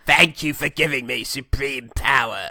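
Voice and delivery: slow, oily villain voice; slow smug Palpatine; mock Palpatine. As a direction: savour it like a scheming senator. Voicing Palpatine accepting power.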